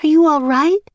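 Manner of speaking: put-on high, worried little girl voice; young girl Annie. A narrator's reading. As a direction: ask gently but anxiously. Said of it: "Annie asked again".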